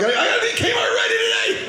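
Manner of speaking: very high-pitched comic yell; mock frantic shout. Mock daily panic on waking.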